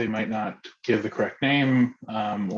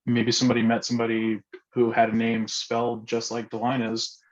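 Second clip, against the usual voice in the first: ordinary talk, nothing marked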